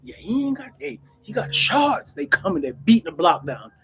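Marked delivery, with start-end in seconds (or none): 0.0-2.1 higher, excited junkie chatter; eager customers calling out. Retold or imitated. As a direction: mimic buyers spreading the word. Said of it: "they like this".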